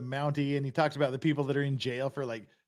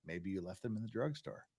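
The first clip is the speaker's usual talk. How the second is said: low, much softer reply; deadpan punchline; 7 semitones lower, 12 dB quieter than usual. Acted. Bus driver answering a frantic passenger, low deadpan. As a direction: drop it down low and understated.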